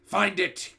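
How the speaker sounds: Grand High Witch crying out; cried. A narrator's reading. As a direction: bark the order. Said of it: "cried the Grand High Witch".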